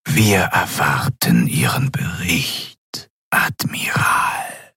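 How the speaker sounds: slow, whispered voice of command; menacing Excellency. A narrator's reading. Ruler demanding the admiral's report, slow menacing whisper. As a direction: whisper each word with drawn-out authority.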